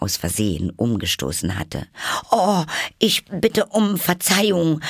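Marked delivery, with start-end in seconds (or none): 2.3-4.8 high, slow flustered apology; dismayed. A narrator's reading. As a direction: apologise in alarm. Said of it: "rief sie sehr bestürzt".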